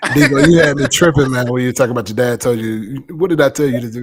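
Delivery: loud, excited retort. Real, not acted. Excited laughing retort to friend.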